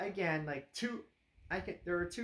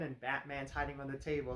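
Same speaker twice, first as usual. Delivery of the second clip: ordinary delivery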